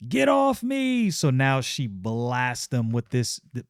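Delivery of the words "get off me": high, drawn-out cry of get off; mock shout. Yaira cries out as she is grabbed, high and drawn out. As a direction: push them away with a high yell.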